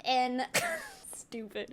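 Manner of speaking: normal talking voice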